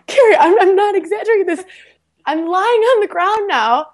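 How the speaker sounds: high, fast, laughing through the words. Real, not acted. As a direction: laugh as you confess the embarrassing bit. Laughing while telling embarrassing moment.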